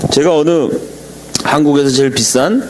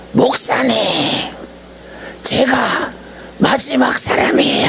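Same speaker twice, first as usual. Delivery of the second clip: much higher, strained, breathy-edged mock yell of an old principal; retold excited cry; 9 semitones higher, 4 dB quieter than usual. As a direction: cry out '마지막' high as if overwhelmed. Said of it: "하면서 소리를 질러요".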